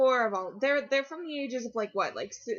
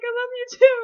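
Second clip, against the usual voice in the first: much higher, louder squeal on "I love you too"; overwhelmed fan; 14 semitones higher, 10 dB louder, faster than usual. Retold or imitated. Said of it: "close to tears".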